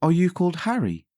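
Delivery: high teasing guess; queen teasing. A narrator's reading. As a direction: keep him guessing. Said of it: "she asked again".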